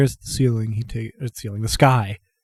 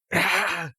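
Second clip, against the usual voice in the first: short, high acted cough; abbreviated coughing fit; 8 semitones higher, 4 dB quieter than usual. Old man after his outburst, brief acted coughing fit. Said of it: "accelerated coughing fit there".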